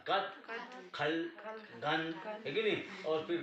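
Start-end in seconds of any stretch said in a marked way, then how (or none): 0.1-2.1 कत, खल, गन as short single syllables; one light syllable each, spaced by pauses; final vowel dropped. Shown as a demonstration. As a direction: end कत, खल, गन on the consonant. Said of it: "आ को निकाल दिया तो क्या हो गया".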